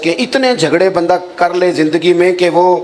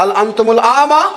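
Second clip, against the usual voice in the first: indignant retort; 7 semitones higher than usual. Retold or imitated. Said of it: "اس بزرگ نے بھی یہی جملے کہے".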